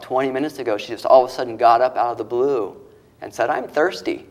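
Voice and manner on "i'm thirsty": put-on high little-girl voice; child's voice. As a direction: say it like a small child. Daughter's words.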